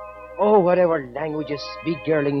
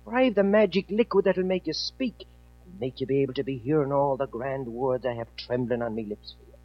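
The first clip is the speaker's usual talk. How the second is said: lower, softer, tender and soothing; tender comfort; 4 semitones lower, 4 dB quieter than usual. Acted. Jamie soothes his weeping sweetheart, soft and low. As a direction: coax her gently as she weeps.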